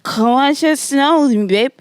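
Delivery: wasted, slurred come-on; drunk boyfriend. Retold or imitated. Wasted guy on the couch calling his date over, drunk slur. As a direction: imitate a wasted man coaxing from the couch. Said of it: "he's wasted on the couch".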